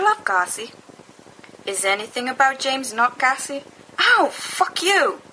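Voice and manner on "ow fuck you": drawn-out pained yelp. Acted. Hit after teasing.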